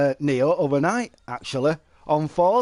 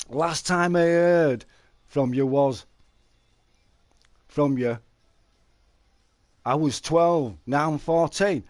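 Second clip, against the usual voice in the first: normal talking voice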